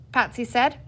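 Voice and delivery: plain reading voice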